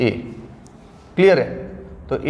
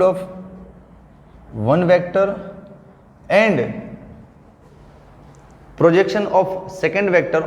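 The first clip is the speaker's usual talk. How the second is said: spelling it out word by word for notes; slow, measured classroom dictation with long pauses; much slower than usual. Acted. Teacher dictates the definition to students, slow and deliberate.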